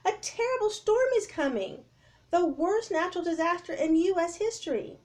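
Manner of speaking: high loud warning; urgent yell. Acted. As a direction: make them believe the danger. Jack warning crowd.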